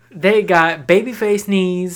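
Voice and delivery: drawn-out, emphatic comic roll call; performed joke routine. Acted. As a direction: recite it like a hype bit, hitting each item. Performs an old high school joke.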